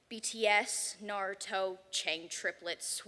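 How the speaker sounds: ordinary delivery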